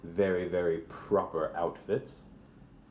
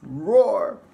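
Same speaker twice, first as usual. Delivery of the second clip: loud high roaring tiger; 14 semitones higher, 11 dB louder than usual. Acted. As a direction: roar it out like a wild tiger. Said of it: "he goes".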